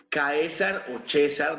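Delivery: Caesar said ka-e-sar, then César said the Spanish way, slowly; two Latin readings. Shown as a demonstration. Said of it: "dependiendo del latín que utilicemos".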